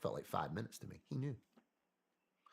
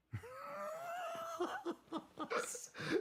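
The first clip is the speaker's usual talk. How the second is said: big laugh. Real, not acted. Telling his story, he bursts out laughing. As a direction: crack up at the punchline.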